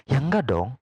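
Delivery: usual speaking voice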